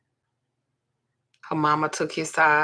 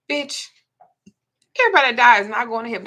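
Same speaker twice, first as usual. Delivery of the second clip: loud, fast, higher outburst; emphatic rant; 5 semitones higher, 8 dB louder, faster than usual. Real, not acted. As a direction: fire it back at the cliché. Ranting against a pious saying.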